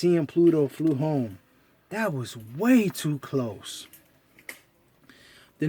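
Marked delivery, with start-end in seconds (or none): 1.9-3.9 slow relief. Acted. Relief after narrow escape.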